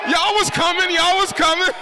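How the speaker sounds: high, loud playful shout; a hype man teasing the cheering crowd; hyped crowd tease. Real, not acted.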